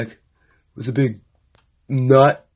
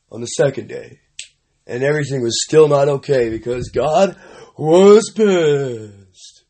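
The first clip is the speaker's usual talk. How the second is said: normal talking voice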